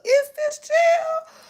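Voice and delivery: very high, slow mock question; drawn-out, exasperated high pitch; incredulous. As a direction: squeal it out in disbelief. Repeats mock disbelieving question.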